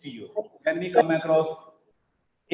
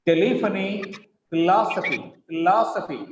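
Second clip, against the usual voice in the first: "telephony" stressed on "leph", "philosophy" on "los"; stress before the ending. Shown as a demonstration. Teacher correcting a reading, "telephony" and "philosophy" stressed on the second syllable. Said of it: "The syllable preceding the ending gets stress".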